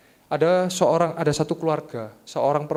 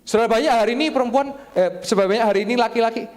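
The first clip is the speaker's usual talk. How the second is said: lively call-out; 7 semitones higher, 6 dB louder, faster than usual. Real, not acted. Calling out to congregation.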